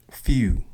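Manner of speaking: "few" with a drawn-out, stressed U; slow "few" with the vowel stretched; stressed U sound. Shown as a demonstration. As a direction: lean on the U in "few", Baltimore style. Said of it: "the stress of the U sound in many words".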